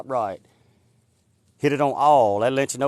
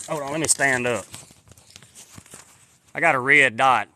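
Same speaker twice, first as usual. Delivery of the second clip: ordinary delivery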